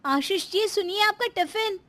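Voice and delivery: very high-pitched wife calling out; high, carrying call; calling after him. A narrator's reading. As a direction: call to your husband from behind. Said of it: "पीछे से प्रियंका आवाज़ लगाती है".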